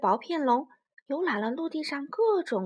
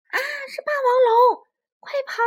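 very high, loud panic shriek; little dinosaurs woken by T-rex, screaming in fear; terrified screams; 12 semitones higher, 9 dB louder than usual. Acted. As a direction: scream and flee. Little dinosaurs startled awake.

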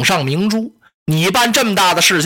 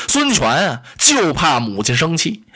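usual speaking voice

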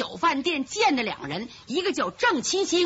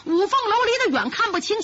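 ordinary delivery, nothing marked

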